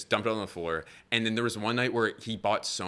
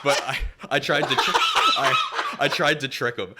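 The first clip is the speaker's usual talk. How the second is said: laughing; 5 semitones higher, 7 dB louder than usual. Real, not acted. Laughing through the story.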